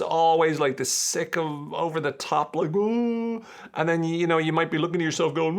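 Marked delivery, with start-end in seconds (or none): 2.7-3.4 very high swooning oh; gushing oh. Pretend. Mocking lovestruck couples, very high. Over-the-top couple sigh.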